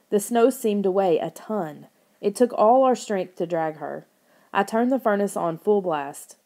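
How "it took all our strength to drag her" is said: ordinary delivery, nothing marked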